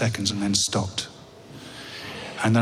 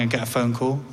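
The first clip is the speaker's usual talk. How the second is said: ordinary delivery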